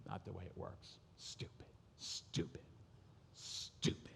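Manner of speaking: hissed under the breath; whispered muttering. Retold or imitated. Son muttering as he walks off, whispered. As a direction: whisper it angrily to yourself.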